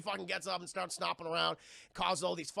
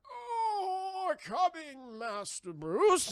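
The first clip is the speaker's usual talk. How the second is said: much higher, strained mock old butler cry; frail Alfred; 10 semitones higher, much slower than usual. Alfred answering Bruce, hammed frail old butler. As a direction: croak it out stumbling. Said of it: "Alfred's just like".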